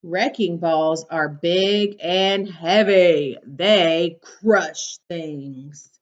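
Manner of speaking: bright, lively storybook read; reading to children. Acted. Teacher reading a picture book to her class, bright and lively.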